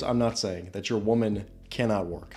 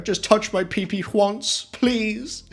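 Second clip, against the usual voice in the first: much higher, hammed-up British begging voice; mock British plea; 9 semitones higher, 6 dB louder than usual. Man begging a woman for a touch, hammed-up British plea. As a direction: grovel like a desperate British suitor. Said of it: "like, British".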